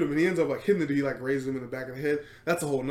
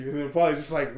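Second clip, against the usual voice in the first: ordinary talk, nothing marked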